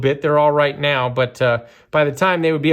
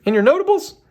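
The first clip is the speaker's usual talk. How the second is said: much higher, incredulous mock viewer; mock skeptical viewer; 12 semitones higher than usual. Imagined viewer scoffing at his notables pick, mock incredulous. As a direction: needle him like a doubting fan. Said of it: "Somebody out there was going".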